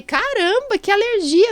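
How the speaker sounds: a big jump up in pitch, amazed; mock-amazed exclamation to a patient; surprised exclaim.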